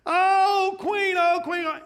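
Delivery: high, loud desperate wail; begging. Acted. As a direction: beg like a man about to die. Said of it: "he's begging for his life".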